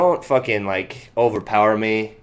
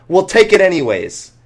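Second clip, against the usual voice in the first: mock pushy lady; 8 semitones higher, 6 dB louder than usual. Witness insisting with a put-on voice.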